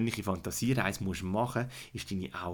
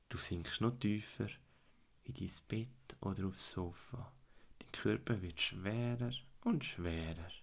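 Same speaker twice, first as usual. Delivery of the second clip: guided fantasy journey. Acted. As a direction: calmly lead a child into relaxation.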